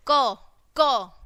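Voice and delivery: क as "kuh", repeated with a pause; phonics sound. Shown as a demonstration. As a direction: sound out each क separately, falling in tone. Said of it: "K की sound कैसे निकालते हैं". (क को अलग-अलग ध्वनि की तरह बोलें)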